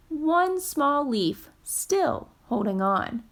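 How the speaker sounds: ordinary delivery, nothing marked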